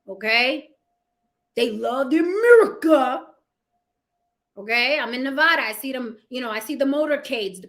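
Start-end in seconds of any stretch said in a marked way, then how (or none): 1.6-3.3 mock Trump fan. Mocking Trump fans.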